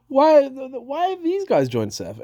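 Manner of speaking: high-pitched disbelieving why; can't believe what he's seeing, pitch shooting up; real exasperated squeak; incredulous outburst. Real, not acted. Exasperated at the Seth faction.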